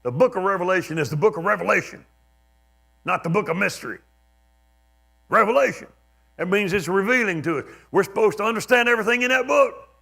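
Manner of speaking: loud, high-pitched imitation of a scolding preacher; mock pulpit shouting, building to the end. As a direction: preach it at the folks, mocking and forceful. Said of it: "He was kind of mocking".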